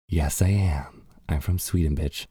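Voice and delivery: hushed, cool mock-diva reply; joking, understated diva attitude; mock sassy comeback. Acting out own sassy comeback, joking.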